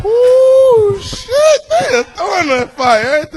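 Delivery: hammed-up wail at being roasted; mock overwhelmed. Real, not acted. Comic reacting to the roast, squealing high and near tears.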